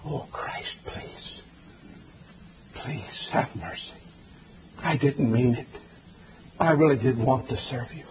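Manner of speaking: hushed, breathless begging for mercy; desperate pleading. Acted. Condemned sinner begging Christ, a hushed plea. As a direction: whisper it like a soul begging to be spared. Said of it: "there you are pleading away".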